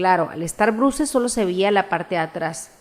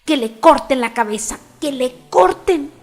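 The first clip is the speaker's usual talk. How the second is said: furious shout; 5 semitones higher, 5 dB louder than usual. A narrator's reading. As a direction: scream the order in fury. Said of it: "empezó a gritar".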